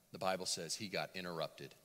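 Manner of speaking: ordinary delivery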